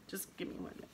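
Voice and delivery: soft whisper so as not to wake him; real whisper. Real, not acted. Mother whispering next to her sleeping newborn son, hushed. Baby asleep beside her.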